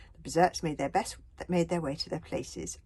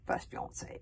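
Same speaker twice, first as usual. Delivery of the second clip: ordinary delivery, nothing marked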